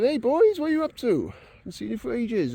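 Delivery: put-on high, loud hail to old mates; imitating a breezy, high-pitched hello; acted cheery greeting. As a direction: call out to friends, playfully acted. Retold fourth friend greets the group.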